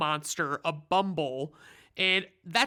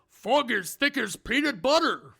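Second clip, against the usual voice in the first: higher gruff prospector voice, hammed up; Yukon voice; 6 semitones higher, 3 dB louder than usual. Recapper voicing Yukon, hammed-up prospector. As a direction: play the old miner, a bit over the top. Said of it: "I hope that voice is okay".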